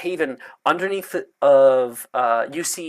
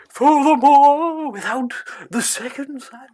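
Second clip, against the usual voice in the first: put-on lightly mystical old professor; Trelawney voice; 12 semitones higher, 6 dB louder than usual. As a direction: float it out like a batty divination teacher.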